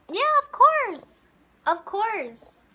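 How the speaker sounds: put-on very high, drawn-out girly voice; high mock-female falsetto, stretched out; put-on girl's voice. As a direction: pitch it way up, slow and girly. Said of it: "I tried doing a female's voice".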